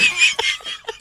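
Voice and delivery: very high squealing burst of laughter. Real, not acted. Big laugh at burning gum.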